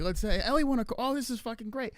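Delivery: high-pitched retold delight; excited celebration. Reliving the game pool win, excited. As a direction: gush it, pitched right up. Retells winning a quarter.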